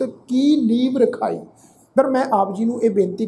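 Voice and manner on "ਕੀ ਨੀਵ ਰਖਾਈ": ਕੀ as a separate word, then ਨੀਵ ਰਖਾਈ; ਕੀ set apart, ਵ closing ਨੀਵ before ਰਖਾਈ; wrong word split. Shown as a demonstration. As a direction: break after ਕੀ and join ਨੀਵ as one word. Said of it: "ਇਸ ਢੰਗ ਦੇ ਨਾਲ ਅਸੀਂ ਨਹੀਂ ਉਚਾਰਨ ਕਰਨਾ".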